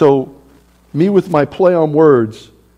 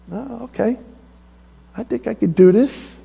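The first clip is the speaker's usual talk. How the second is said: shy new confidence; 7 semitones higher, 3 dB quieter than usual. Acted. Joshua gains shy confidence.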